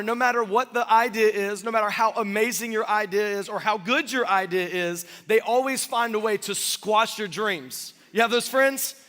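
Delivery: usual speaking voice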